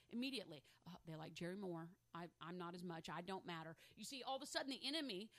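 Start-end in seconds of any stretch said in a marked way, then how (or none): 0.9-3.8 low, quiet mock sulk; self-pitying mope. Passed over for Jerry to pray, low and self-pitying. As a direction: act out a small, dejected inner voice.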